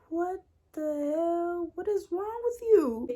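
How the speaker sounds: high, slow, stretched-out "what the hell"; shocked he'd threaten her friend; outraged disbelief. Retold or imitated. To Jerome after he threatens Kiara, outraged disbelief.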